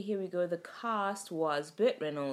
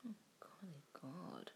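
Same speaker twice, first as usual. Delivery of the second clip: shocked whisper; 18 dB quieter than usual. Real, not acted. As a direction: breathe it out in horror. Reacting to horror.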